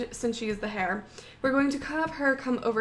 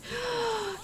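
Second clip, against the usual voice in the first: soft, high shocked gasp; startled gasp; 8 semitones higher, 6 dB quieter than usual. Real, not acted. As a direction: suck in breath in alarm. Startled by the killer in game.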